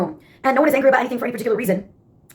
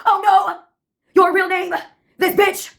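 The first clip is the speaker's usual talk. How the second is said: put-on high-pitched theater-kid outburst; theatrical scream; 5 semitones higher than usual. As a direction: play it like a melodramatic musical chorus. Recurring mock-horrified theater-kid chorus.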